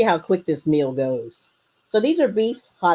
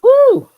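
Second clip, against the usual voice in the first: loud effort whoop; 12 dB louder than usual. Real, not acted. Lifting a heavy grill.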